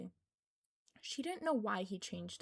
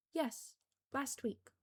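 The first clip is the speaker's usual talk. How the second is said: slow, careful reply; said slowly; 4 semitones higher, slower than usual. A narrator's reading. Girl answering the balancing boy, slowly and carefully. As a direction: draw the words out, watching him. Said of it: "she said slowly".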